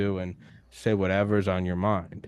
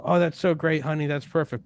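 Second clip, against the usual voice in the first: higher, gushing mom praise; doting mom; 6 semitones higher than usual. Retold or imitated. Son imitating his mom praising anything, gushing and higher. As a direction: gush like a proud mother. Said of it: "My mom would go".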